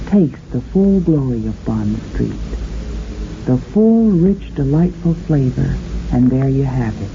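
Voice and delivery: ordinary talk, nothing marked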